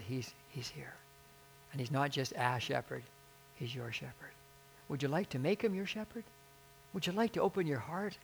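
softly reassuring bedside voice; gentle reassurance. Retold or imitated. Pastor at a dying woman's bedside, gentle reassurance. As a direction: speak softly, as if comforting someone afraid.

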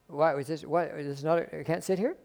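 a mild, meek protest; timid surprise. Retold or imitated. Visitor quietly startled by the usher.

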